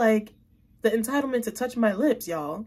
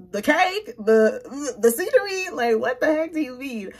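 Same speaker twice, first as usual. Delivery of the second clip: baffled confusion; 4 semitones higher, 4 dB louder than usual. Retold or imitated. Telling her date she's baffled by his compliment, voice jumping high. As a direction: pitch jumps high in puzzled disbelief.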